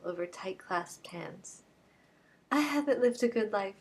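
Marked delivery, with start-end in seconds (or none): 2.5-3.8 a raised, begging plea; kneeling woman begging the detective, pleading; pleading cry. A narrator's reading. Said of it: "she cried".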